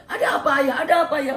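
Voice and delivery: high, loud, puzzled asking; startled question. Acted. Ismail asking his father what happened, high and startled. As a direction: ask it in sudden surprise.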